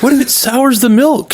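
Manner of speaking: high, worried mock question; quoting a character. Retelling a funny scene to his cohost, high mock worry. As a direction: squeak it out like a fretful crew member. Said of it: "it was very funny when she was like".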